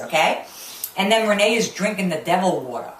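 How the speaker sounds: usual speaking voice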